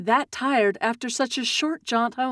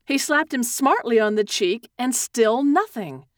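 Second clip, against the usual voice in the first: ordinary delivery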